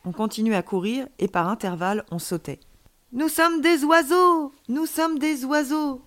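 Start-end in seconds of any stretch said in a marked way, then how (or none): 3.1-6.0 high, slow, singsong cries of glee; joyful exclamation. A narrator's reading. Alien child exclaims while running with friend, joyful. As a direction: stretch it out high, first cry louder. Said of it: "s'exclama-t-il en riant".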